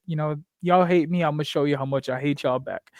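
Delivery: ordinary delivery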